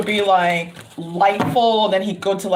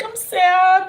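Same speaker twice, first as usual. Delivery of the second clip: mock regret; 11 semitones higher than usual. Feigned regret at leaving, retold.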